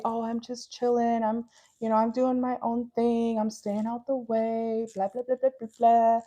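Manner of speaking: breezy mock excuse, dragged out; mock chilled-out. Mocks someone claiming to be chill.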